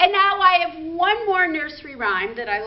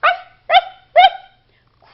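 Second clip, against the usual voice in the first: high yappy little ruff ruff, much louder; puppy barking; 13 dB louder, slower than usual. Pretend. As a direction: bark it out like an excited puppy. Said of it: "Bark like a dog".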